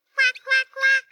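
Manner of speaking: duckling quack. Acted. Duckling answers in story.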